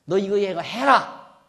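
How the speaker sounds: loud, slow, jabbing accusation at Job; mock accusing shout. 욥을 꾸짖는 친구 소발을 흉내 내며 크고 느린 호통. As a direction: bark it out slowly like a scolding friend. Preacher voicing accusing friend.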